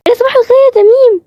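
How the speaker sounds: very high, loud, cutesy greeting; sugary high-pitched good morning; affected voice. Acted. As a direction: gush the greeting in a fake sweet voice. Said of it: "كل شوية تتكلم بطريقة مختلفة".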